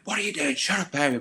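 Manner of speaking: high, sharp mock telling-off; a coach breaking up a dressing-room scrap, mock anger; retold telling-off. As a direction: snap at him like an annoyed coach. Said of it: "Les comes in and he's just like".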